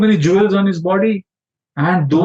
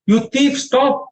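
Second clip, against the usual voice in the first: calling out a thief; 10 semitones higher, slower than usual. Acted. Gopi challenges running thief.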